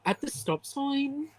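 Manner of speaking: slow, fed-up sat-nav drawl; fed-up GPS voice. Retold or imitated. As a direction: sigh out the direction like a bored sat-nav. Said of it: "so fed up with giving directions".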